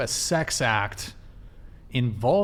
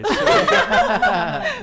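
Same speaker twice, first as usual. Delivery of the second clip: laughing; 9 dB louder than usual. Real, not acted. Laughs at pegging joke.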